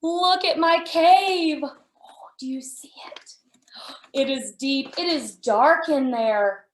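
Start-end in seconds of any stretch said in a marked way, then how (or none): 0.0-1.6 excited. Shown as a demonstration. Showing the cave again, excitedly. 1.6-3.4 hushed whisper of a secret; breathy storytime whisper to the kids. Acted. Secret-sharing with the children.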